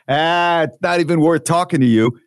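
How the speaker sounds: lightly voiced Brooklyn dismissal; thick Brooklyn accent. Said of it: "she's got this thick Brooklyn accent".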